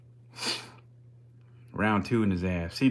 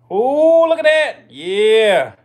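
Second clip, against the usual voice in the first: excited yelling; 11 semitones higher, 11 dB louder, much slower than usual. Real, not acted. Reacting to snake yawn.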